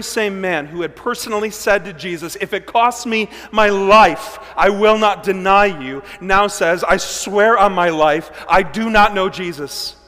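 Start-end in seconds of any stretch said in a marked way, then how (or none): 2.4-6.0 loud, slow, fervent promise; earnest vow. Acted. Peter's earlier vow to Jesus. 6.8-10.0 loud, slow, forceful oath; desperate denial. Acted. Peter's denial contrasted with vow.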